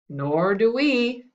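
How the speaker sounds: slow, drawn-out chorus of knights. Acted. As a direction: say it together like a group. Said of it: "chorused the knights".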